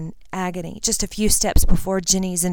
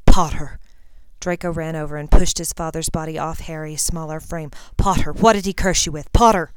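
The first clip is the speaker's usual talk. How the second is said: loud, alarmed calling; urgent call; 5 dB louder than usual. Acted. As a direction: shout to rouse him. Draco calling to dying Harry.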